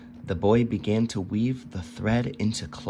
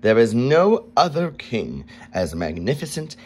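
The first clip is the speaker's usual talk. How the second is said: louder, proud boast; king boasting of his magnificence; 3 semitones higher, 7 dB louder than usual. A narrator's reading. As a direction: puff up with royal pride. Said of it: "he boasted".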